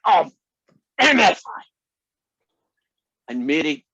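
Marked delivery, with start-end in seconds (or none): 0.1-1.6 much higher, loud hammed-up outburst; pretend anger. Grandpa faking anger in front of the toddler, loud mock outburst. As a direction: fake a furious curse for the child. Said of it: "I pretended to get really annoyed".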